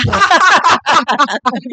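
real, loud laughing fit; burst of laughter. Real, not acted. Panelist cracking up at talk of acueducto, laughing. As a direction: crack up over a silly word.

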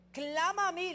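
loud, high commanding call; voice of God. Acted. Preacher voicing God's call to the faithful, loud and lofty. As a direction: boom it out as God calling his people. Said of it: "le dice Dios".

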